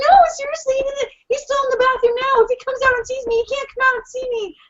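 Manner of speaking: high, frantic insistence; urgent, high-pitched retold panic; imitated freak-out. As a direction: act out a panicking friend's plea. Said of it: "freaking out".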